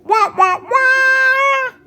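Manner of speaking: loud, very high wah-wahs; vocal mimicry of a blaring horn; trumpet imitation. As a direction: blare it like a trumpet, holding the last note. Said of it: "a girl is playing trumpet".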